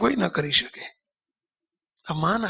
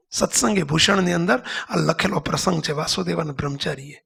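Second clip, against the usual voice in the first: ordinary talk, nothing marked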